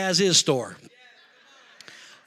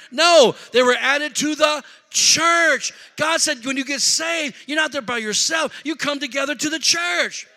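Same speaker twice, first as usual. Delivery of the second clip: raised pitch, ringing preacher's declaration; impassioned preaching; 8 semitones higher, 5 dB louder than usual. Acted. Preacher telling the saved they belong together, impassioned preaching. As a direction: drive the point home to the congregation.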